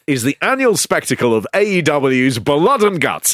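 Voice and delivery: upbeat hammed-up radio trailer; mock Capital Radio announcer, half laughing; radio promo voice. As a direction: sell it like a cheesy radio link. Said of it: "I'm gonna do one of those Capital Radio links".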